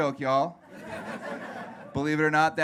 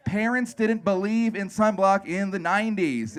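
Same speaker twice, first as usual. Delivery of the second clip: ordinary talk, nothing marked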